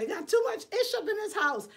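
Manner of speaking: high-pitched exasperated jab at the clutter; mocking complaint to ex. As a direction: whine it up high like nagging about the mess. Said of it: "blame my ex and say".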